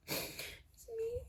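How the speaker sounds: high, voice catching on tears; choked up. Retold or imitated. Telling boyfriend on the phone, can barely speak, choked up. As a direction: struggle to get the words out. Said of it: "I can't even get a word out".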